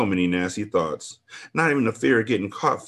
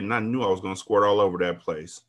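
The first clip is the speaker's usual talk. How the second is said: ordinary talk, nothing marked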